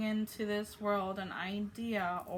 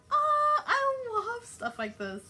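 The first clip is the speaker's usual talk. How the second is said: very high, loud, slow squeal of joy; real excited squeal; delighted; 8 dB louder, slower than usual. Real, not acted. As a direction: gush with delight. Delighted reaction.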